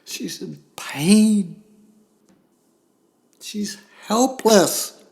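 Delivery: slow, high, loud preaching; preaching at full force. Acted. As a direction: drive each word home from the pulpit. Preacher builds intensity.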